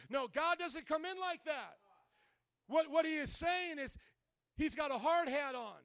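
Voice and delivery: ordinary talk, nothing marked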